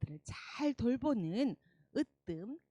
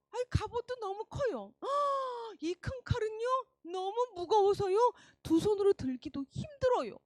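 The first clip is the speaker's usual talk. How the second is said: put-on whiny complaining boy; 10 semitones higher, 5 dB louder than usual. Boy struggling with oversized armour.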